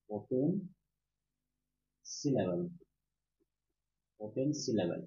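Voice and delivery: term split by a long pause; word-by-word dictation. Shown as a demonstration. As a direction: dictate it slowly, one word at a time.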